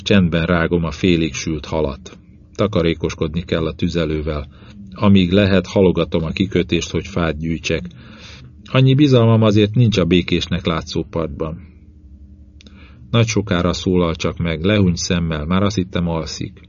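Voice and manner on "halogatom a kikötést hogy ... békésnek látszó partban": usual speaking voice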